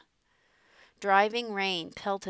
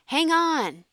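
urgent shout; 9 semitones higher, 9 dB louder than usual. A narrator's reading. Father grabs Star.

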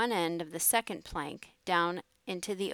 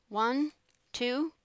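raised, higher count for a heave; called-out count; 6 semitones higher, 3 dB louder than usual. A narrator's reading. Sharky counts for a joint push, called-out count. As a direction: call the count to a partner.

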